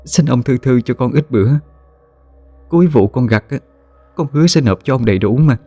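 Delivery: cringing plea. Acted. Mother begs the village chief for time, high and humble. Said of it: "giọng điệu khúm núm cầu xin".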